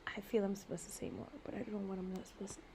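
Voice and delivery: soft unvoiced whisper. Real, not acted. Unsure what to say, whispering to the camera. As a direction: confide it under your breath.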